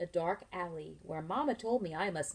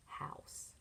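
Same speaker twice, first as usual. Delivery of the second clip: whispered reveal; 9 dB quieter than usual. Shown as a demonstration. Teacher whispering the answer to children, hushed. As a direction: whisper the word. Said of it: "suspense reveal to class".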